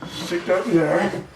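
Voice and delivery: speaking with screws in mouth; words muffled by held screws. Real, not acted. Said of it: "that's why I sound funny".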